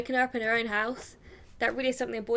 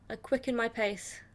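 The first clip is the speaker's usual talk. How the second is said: usual speaking voice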